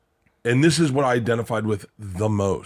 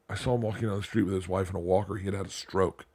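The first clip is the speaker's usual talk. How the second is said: hushed and somber; 5 semitones lower, 8 dB quieter, faster than usual. Real, not acted. Somber reveal of neighbor's stroke.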